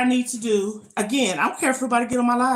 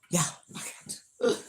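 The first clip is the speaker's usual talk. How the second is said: breathless whisper; 3 semitones lower, 7 dB quieter than usual. Real, not acted. Mid-exercise, breathless self-pep.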